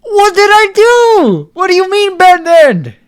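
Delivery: very high, loud, slow cry of disbelief; stunned squeal. Real, not acted. Gamer crying out after getting the bad ending, stunned. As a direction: wail in disbelief.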